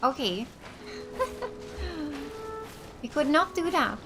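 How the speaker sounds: ordinary talk, nothing marked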